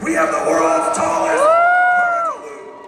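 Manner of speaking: high, loud excited call; excited shout. Real, not acted. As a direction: shout it out to the crowd. Shouting record to crowd.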